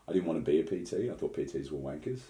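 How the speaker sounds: ordinary talk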